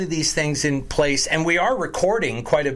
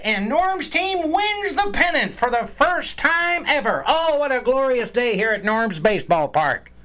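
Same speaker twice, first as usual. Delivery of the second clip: high, excited play-by-play call; baseball announcer voice; 8 semitones higher than usual. Pretend. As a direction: call the game like a ballpark announcer. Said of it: "your best baseball announcer voice".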